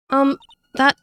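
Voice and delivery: high, loud, halting reply; falter, shocked at hearing the name; alarmed hesitation. Acted. Sister confronted with her name.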